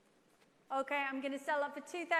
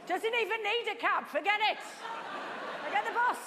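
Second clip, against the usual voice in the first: excited shout; 7 semitones higher, 7 dB louder than usual. Real, not acted. Auctioneer hyping a big sale.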